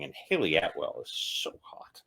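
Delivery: hushed confiding whisper. Real, not acted. Aside to viewers about Haley Atwell, whispered. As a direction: whisper it like a guilty secret.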